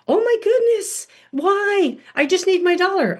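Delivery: whiny little daughter. Retold or imitated. Mother voicing her daughter begging for her dollar, high childish whine. Daughter protests being taken home.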